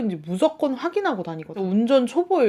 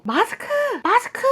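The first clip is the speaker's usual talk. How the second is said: calling out; 12 semitones higher than usual. Retold or imitated. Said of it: "이런 소리가 들리는 거예요".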